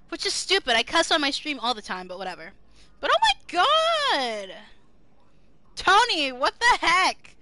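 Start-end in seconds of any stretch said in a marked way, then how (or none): 3.0-7.4 amazed. Real, not acted. Streamer amazed at Tony's channel, loud. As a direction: stretch it out in awe.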